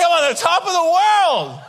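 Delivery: high, exultant cry; triumphant shout. Retold or imitated. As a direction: call it out like a triumphant winner. Retells his triumph, calling out.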